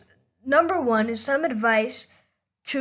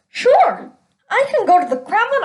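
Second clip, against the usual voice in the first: higher, louder, a bit quicker and eager; eager boast; 9 semitones higher, 4 dB louder than usual. Acted. Russian guy brags he can do the same, eager and louder. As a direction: brag it out like a proud Russian guy.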